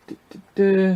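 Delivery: ordinary delivery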